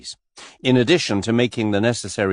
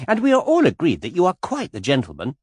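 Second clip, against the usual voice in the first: put-on much higher, girlish gushing voice; skittish young lady; 8 semitones higher, 5 dB louder than usual. A narrator's reading. Miss Taylor pestering Mister Cade, high and gushing. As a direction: flutter up high like a giddy girl.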